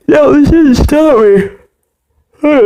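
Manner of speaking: said through a big yawn. Real, not acted. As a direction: stretch the words out mid-yawn. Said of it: "there's a lot of yawning in this episode".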